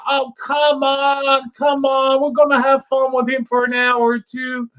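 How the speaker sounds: hammed-up taunting holler; mock taunting scream. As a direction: yell after him as the cops cart him off. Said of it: "we're screaming at the guy".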